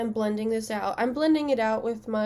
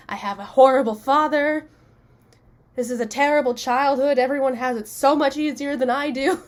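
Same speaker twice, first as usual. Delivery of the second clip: put-on loud, higher self-pitying kid tantrum; mock childish rant; 3 semitones higher, 7 dB louder than usual. Kid rants about her father, hammed-up whiny tantrum. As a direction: ham up a sulking ten-year-old's complaint. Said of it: "I was so mad".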